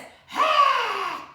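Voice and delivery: loud, high breathy roar from the throat; loud lion's breath roar. Shown as a demonstration. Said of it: "Way from the back of your throat".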